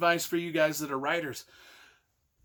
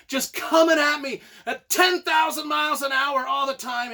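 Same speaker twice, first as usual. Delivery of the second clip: higher and louder, nearly shouting; excited shout; 8 semitones higher, 5 dB louder than usual. Acted. Reader overwhelmed by the book, shouting with excitement. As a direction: get swept away by the rush. Fan gushing about the book's chaos.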